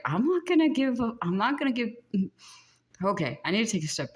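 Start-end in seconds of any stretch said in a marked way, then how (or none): 0.0-1.9 defiant vow. Retold or imitated. Psychic voicing the client's defiant higher self, fired up. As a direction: declare you won't quit, fired up. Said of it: "You're like".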